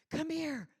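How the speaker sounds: very high, loud yell; sharp, demanding shout; urgent call for help. Shown as a demonstration. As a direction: holler for someone to come help. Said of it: "I, I need help".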